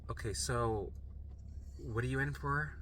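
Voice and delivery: soft, mildly weary intake question; tired nurse. Retold or imitated. Nurse to a frequent patient, a touch of weariness. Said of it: "they're fucking tired of me".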